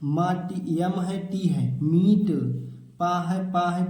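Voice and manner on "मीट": "मीट" loud, with a stretched ee; long ee vowel. Shown as a demonstration. Teacher modelling double-e for children, long-vowel "मीट". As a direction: punch and hold the ee in "मीट". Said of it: "बड़ी की मात्रा लगा देंगे".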